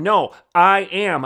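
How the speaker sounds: loud, ringing I am; booming declaration. Acted. Preacher to his flock, a loud ringing declaration. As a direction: proclaim it to the rafters. Declaring God's name with force.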